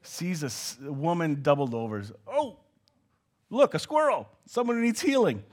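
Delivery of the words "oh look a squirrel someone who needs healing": mock distracted. Comic distracted Jesus voice.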